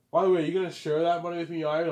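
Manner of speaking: a greedy woman asking Ivan for money; wheedling woman. Retold or imitated. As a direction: angle for a share of the money.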